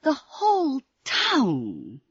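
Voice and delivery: slow, breathless mailman voice; a mild gasp of shock. Acted. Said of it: "Sandy gasped".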